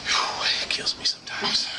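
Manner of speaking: breathy whispered aside; whispering. Real, not acted. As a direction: whisper it to the crowd. Aside to audience.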